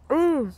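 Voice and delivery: high pained ooh; sour wince. Real, not acted. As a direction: squeal at a sour bite. Another sour bite.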